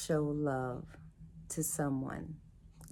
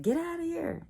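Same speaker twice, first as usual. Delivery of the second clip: high mocking brush-off, much higher; mock dismissive commenter; 12 semitones higher than usual. Mimicking a Facebook group member telling her to leave, in a high mock sneer. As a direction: toss them out in a sneering squeal.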